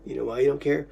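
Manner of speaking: low, menacing male voice; a deep voice from the other side. Pretend. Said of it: "I'm doing the male voice".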